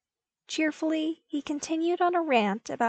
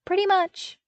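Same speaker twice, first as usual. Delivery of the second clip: much higher and louder, happy; happy agreement; 12 semitones higher, 6 dB louder than usual. A narrator's reading. Luke agreeing happily with Vader, high and bright. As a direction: chirp it out cheerfully. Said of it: "he agreed happily".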